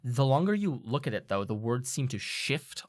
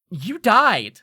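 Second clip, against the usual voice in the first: loud, high acted outburst; shocked blurt; 8 semitones higher, 10 dB louder than usual. Asking a returned friend a rude question, shocked blurt.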